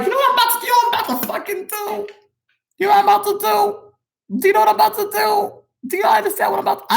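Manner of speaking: squealing, very high and excited; hyped-up high voice. Retold or imitated. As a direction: hype yourself up, voice way up high. Hyped-up drunk self-talk, retold.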